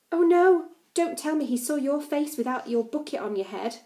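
high, loud cry of alarm; mock-horrified outcry; Oh no blurted high and loud; cried out. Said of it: "I cried".

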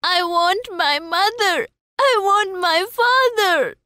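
put-on high child's wail of refusal; crying child. A narrator's reading. Child refuses a roundabout ride, high crying wail. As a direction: refuse with a high, insistent cry. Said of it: "the child refused and cried".

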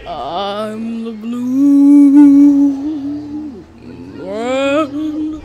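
slow, booming monster voice; mock monster boast; hammed-up giant. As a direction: stomp around announcing yourself as a giant.

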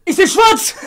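high, agitated cry; upset high voice; high-pitched complaint. Real, not acted. Panicking about paint in eyes.

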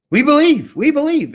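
high eager crowd call; crowd answering. Retold or imitated. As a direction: shout it back like an eager crowd.

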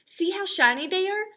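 put-on high, loud little girl showing off; proud and eager; child showing off new shoes, high and loud; excited kid.

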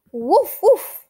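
loud high yappy puppy woof; puppy barks. Acted. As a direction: bark like an eager little puppy. Voicing the puppy Biscuit.

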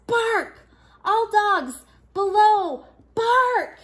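high, loud warning call; barking alarm. A narrator's reading. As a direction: sound the alarm to everyone below. Watchdog warns all dogs.

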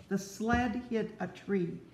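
ordinary delivery, nothing marked

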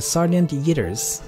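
normal talking voice

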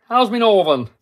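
broader northern English, "my" as "me"; northern accent. Pretend. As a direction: lean harder into the northern accent. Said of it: "I'm not doing it right today".

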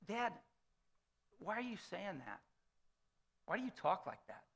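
put-on quiet, near tears, like a wounded kid; hurt child. Son near tears asking his dad why he talks that way, soft and hurt. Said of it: "you could almost see the start of a tear".

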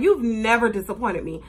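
ordinary talk, nothing marked

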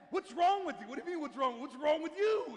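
loud, high, exasperated mock argument; mad quarrel. Married couple quarrel after her dream, mad. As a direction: act out an indignant couple snapping at each other. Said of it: "she wakes up mad".

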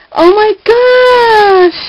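high, slow, drawn-out delight; real gushing amazement; overjoyed. Real, not acted. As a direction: stretch it out high and loud, thrilled. Delighted at opening a gift.

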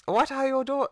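put-on very high caricatured Chinese accent; Jackie Chan. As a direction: crude high-pitched mock Chinese accent. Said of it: "racist caricature of a voice".

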